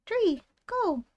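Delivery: put-on high chirpy baby voice; tiny creature naming itself. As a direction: squeak your own name proudly. Baby creature says its name.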